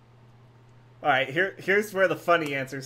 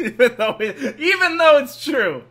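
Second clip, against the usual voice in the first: laughing; 8 semitones higher, 6 dB louder than usual. Real, not acted. Laughing at the game's advice.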